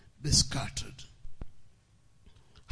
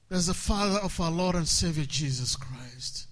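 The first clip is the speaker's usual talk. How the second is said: usual speaking voice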